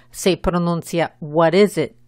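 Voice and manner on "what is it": "What is it?" with the stress on "is". Shown as a demonstration. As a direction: punch "is", short I's and a Z sound for its S. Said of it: "El énfasis va en la segunda sílaba".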